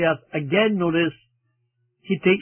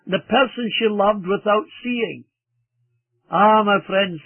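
ordinary delivery

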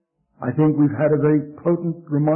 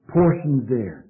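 ordinary talk

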